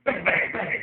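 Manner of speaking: high, loud volley of bangs; shouted joke-gun shots; mock gunfire. Comic punctuating his joke, shouted mock gunfire. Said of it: "See, that's a joke gun".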